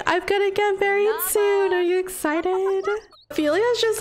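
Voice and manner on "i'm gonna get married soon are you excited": a lightly voiced giddy bride, a bit higher; half-playful squeal to her own tickets; voicing excited bride. Bride gushing to her tickets, lightly mock-giddy. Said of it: "talking to her tickets and being like".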